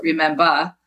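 REMEMBER as "rememba", Jersey style; Jersey accent. Shown as a demonstration. Correcting to mother's way of saying it, Jersey accent. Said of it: "not remember, but remember".

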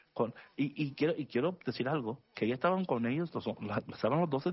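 normal talking voice

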